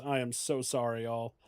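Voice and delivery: ordinary talk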